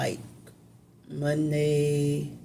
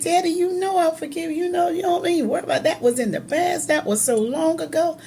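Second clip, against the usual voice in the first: retold, much higher, quick and laughing; laughing forgiveness; 13 semitones higher, faster than usual. Daughter forgiving her dying father, laughing it off. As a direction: brush off his apology with a laugh. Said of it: "I was like".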